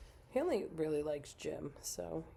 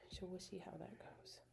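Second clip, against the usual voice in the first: whisper; 3 semitones higher, 11 dB quieter than usual. Real, not acted. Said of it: "repeated whispered echo".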